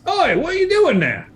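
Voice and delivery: high, loud spider shout; spider's challenge. Acted. GM's giant spider challenges the intruders, high challenging shout.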